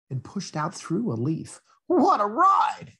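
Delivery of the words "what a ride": much higher, slow delighted cry; thrilled exclamation. Acted. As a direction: shout it like after a thrill ride. Drop pushed out of leaf.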